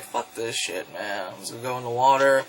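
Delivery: low, soft grumble; imitated weary muttering under the breath; Godzilla. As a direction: grumble it low, like a fed-up monster. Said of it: "walks in the water like".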